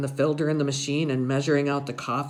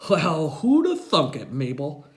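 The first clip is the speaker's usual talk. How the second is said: a hint of a wry old man, louder; lightly voiced grandpa; 5 dB louder than usual. A narrator's reading. Grandpa joking to grandma, a hint of wry old man. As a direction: tease it out like a dry old grandpa. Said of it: "he's saying".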